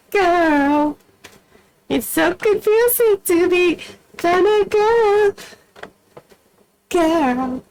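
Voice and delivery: very high, stretched silly voice. Acted. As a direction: squeak it out playfully. Playful exaggerated voice.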